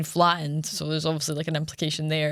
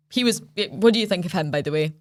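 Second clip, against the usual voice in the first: ordinary delivery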